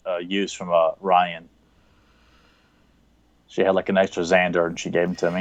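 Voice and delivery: ordinary talk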